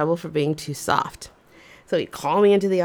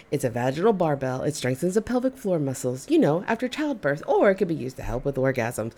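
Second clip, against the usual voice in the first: very fast and lower, rattled off; rushed, embarrassed; 5 semitones lower, faster than usual. Retold or imitated. Embarrassed employee rushing the answer to the office men. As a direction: blurt it all out fast to get it over with. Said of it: "said so fast I was hoping it was inaudible".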